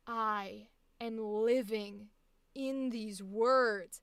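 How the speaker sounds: slow, leaning on each word; slow, weighty demo. Shown as a demonstration. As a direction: show how to live in the words, slowly. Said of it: "the second one was much more".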